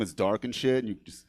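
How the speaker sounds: usual speaking voice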